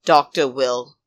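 usual speaking voice

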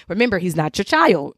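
usual speaking voice

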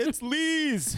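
squealed retold excuse; mock panicked blurt. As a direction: blurt the blame in fake panic. Retold blaming a friend to mom.

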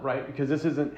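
ordinary talk, nothing marked

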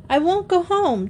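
put-on high, whiny little-boy protest; acted tearful refusal, high; mock child's sob. A narrator's reading. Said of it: "Terry sobbed".

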